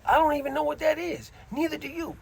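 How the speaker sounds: lightly acted high-voiced plea to a friend; retold insistence. Inmate warning cellmate about unknown dope, retold high-pitched insistence.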